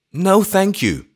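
high, loud, flustered refusal; embarrassed, high and loud; embarrassed old vicar. A narrator's reading. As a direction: refuse as if ashamed. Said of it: "with indescribable embarrassment".

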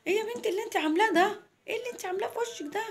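high, rapid scolding as grandma. Acted. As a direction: fire shocked questions at the granddaughter. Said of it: "قالت لها". (تيتا بتزعق باستنكار وبسرعة)

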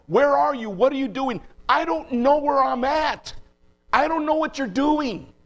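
high, forceful cry of bewilderment; prophet's anguished tone. Retold or imitated. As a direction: cry out to God, pitch pushed right up. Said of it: "by his tone".

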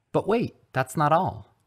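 high, loud, eager build-up; excited. A narrator's reading. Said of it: "Ronald continued excitedly".

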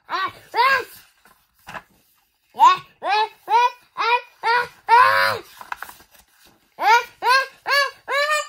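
loud high gasps rising toward a sneeze; building sneeze. Acted. Gerald about to sneeze on Piggy, rising loud gasps.

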